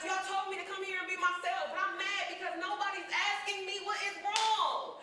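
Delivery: high, loud, raw protest at the counselors; angry outburst. Retold or imitated. Teenage girl yelling at anger management staff, indignant and loud. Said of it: "And I'm like".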